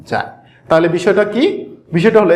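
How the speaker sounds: ordinary delivery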